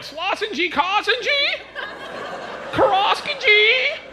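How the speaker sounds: put-on high, drawn-out whiny kid babble. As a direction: squeal it like a crying toddler.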